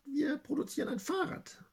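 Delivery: ordinary talk, nothing marked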